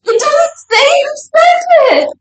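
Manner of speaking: real high squealing laugh-cry; helpless laughter stretching out the words. Real, not acted. Opening a surprise package with no sender named, laughing.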